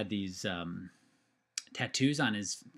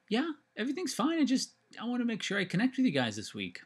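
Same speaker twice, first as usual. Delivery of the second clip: reassuring; 12 semitones higher than usual. Retold or imitated. Reassuring mom on the phone.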